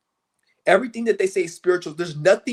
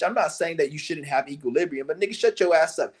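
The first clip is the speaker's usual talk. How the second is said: ordinary delivery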